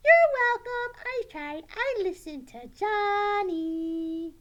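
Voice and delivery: high puppet voice, loud then drawn out; reply as a pleased puppet pupil; pleased puppet Sally. Pretend. Puppet Sally proud of trying.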